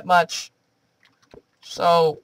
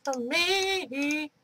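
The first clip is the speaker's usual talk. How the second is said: creepy voice; 11 semitones higher, 6 dB quieter than usual. Pretend. Spooky voice warning in game.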